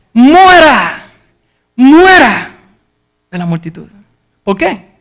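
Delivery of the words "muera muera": a high shouted cry of the crowd; mob shouting. Retold or imitated. Preacher voicing the crowd calling for Paul's death, shouted. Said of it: "el mismo grito de ira".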